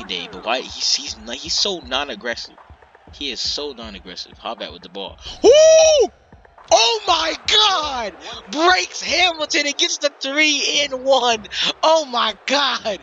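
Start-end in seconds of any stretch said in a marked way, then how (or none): 5.4-13.0 very high, loud, slow drawn-out yelling; hyped scream. Real, not acted. Commentator screaming at a three and one. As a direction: lose it completely over the play.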